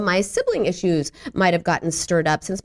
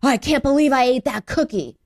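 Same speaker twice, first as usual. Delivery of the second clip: mock gasp of self-disgust, higher; self-reproach; 5 semitones higher than usual. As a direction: scold yourself in mild horror. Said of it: "You cannot be like".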